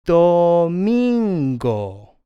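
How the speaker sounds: domingo stretched, DOOO-MIIN-go; slow pronunciation demo. Shown as a demonstration. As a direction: hold each syllable of domingo, slowly. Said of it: "Sunday is".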